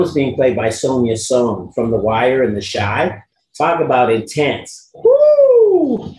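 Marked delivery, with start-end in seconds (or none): 5.0-6.1 high, loud drawn-out "woo"; relieved whoop. Real, not acted. Dad reacting to an intense film set, high whoop. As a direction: let out a long high whoop. Exclaims after talk of intense casting.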